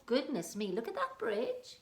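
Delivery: usual speaking voice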